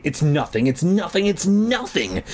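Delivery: rising nervous self-talk; anxious self-reassurance. Acted. Frightened child reassuring himself, rising.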